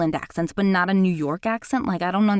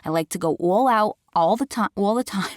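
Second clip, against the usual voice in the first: thick New York accent; strong New York accent. Shown as a demonstration. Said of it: "when I really do it, like, strongly, you can tell".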